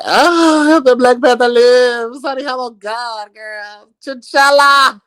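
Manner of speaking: high, loud hammed-up hero cry; high loud mock superhero proclamation. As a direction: ham it up like a movie hero. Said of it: "you know I gotta do the voice".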